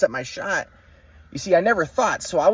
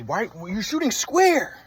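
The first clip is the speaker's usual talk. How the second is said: higher, slow, a touch of a scolding dad; dad yelling at his son about his shot; mock dad yell; 5 semitones higher, much slower than usual. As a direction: drag out a half-hearted imitation of dad's yelling. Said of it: "my dad was yelling at me".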